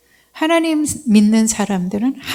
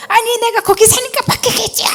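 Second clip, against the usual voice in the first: high, defiant mock snap back; indignant retort; 14 semitones higher than usual. Preacher retelling her own retort to the caller, high indignant. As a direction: throw it back at her, pitched way up. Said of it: "이게 아니에요".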